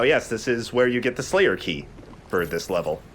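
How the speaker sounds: normal talking voice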